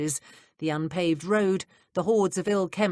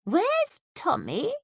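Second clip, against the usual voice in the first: put-on quavery old woman; 13 semitones higher, much slower than usual. A narrator's reading. Old woman asking for Tommy.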